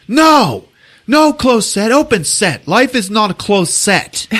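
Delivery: high, loud acted protest; shouted refusal. Director refuses a closed set on his film, shouting. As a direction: imitate a director overruling everyone, loudly.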